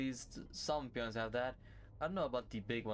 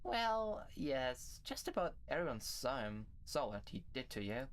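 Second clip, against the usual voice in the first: Oriole; 5 dB quieter than usual. Acted. Plays Oriole, gossiping in story.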